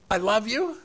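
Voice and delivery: normal talking voice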